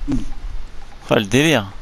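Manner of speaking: ordinary talk, nothing marked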